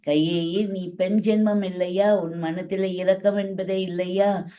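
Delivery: usual speaking voice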